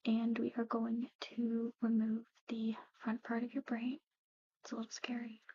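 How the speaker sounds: usual speaking voice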